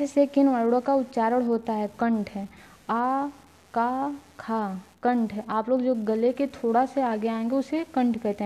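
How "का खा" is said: का and खा drawn out very slowly from the throat; slow demo of guttural letters; slow throat sounds demo. Shown as a demonstration. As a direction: stretch का, खा as slow model sounds. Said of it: "आप लोग बोल के देखिए".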